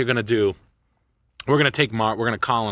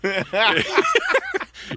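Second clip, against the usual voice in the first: high laugh burst. Real, not acted. Joking about autocorrect.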